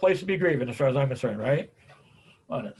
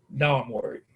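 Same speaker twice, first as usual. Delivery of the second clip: ordinary delivery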